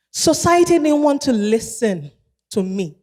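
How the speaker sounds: ordinary talk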